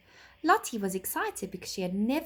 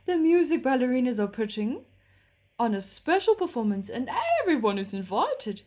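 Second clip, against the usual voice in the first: horse voice; 4 semitones higher, 5 dB louder than usual. A narrator's reading. Horse character voice.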